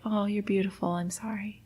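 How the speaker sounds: put-on tender boyfriend. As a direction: soothe a crying partner gently. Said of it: "still is just like".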